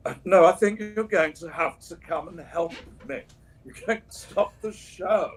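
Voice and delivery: a light theatrical impression of the old actor; imitating Sir Ian. Retelling McKellen's fall onstage, lightly imitated. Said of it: "But then he said".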